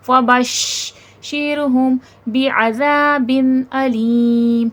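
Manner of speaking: very slow, measured chant; drawn-out tajweed chant; Quranic recitation. Shown as a demonstration. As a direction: recite slowly, giving each letter its due. Teacher recites the verse.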